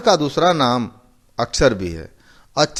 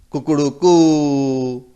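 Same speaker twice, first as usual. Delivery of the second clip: Kukru कू stretched very slow, final कू held long; drawn-out rooster call; much slower than usual. Shown as a demonstration. Teacher imitating a rooster to show a long vowel. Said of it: "जब उसको देर तक कहते हैं".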